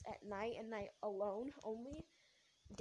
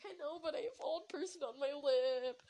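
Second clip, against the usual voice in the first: mock sobbing; 5 semitones higher, 8 dB louder than usual. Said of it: "And I was just sobbing".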